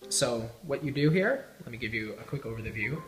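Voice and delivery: usual speaking voice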